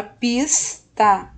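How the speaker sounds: PISTA split as PIS... TA; two separate beats on PISTA; syllable by syllable. Shown as a demonstration. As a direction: hold the S in PISTA, pause, then TA. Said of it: "A primeira sílaba".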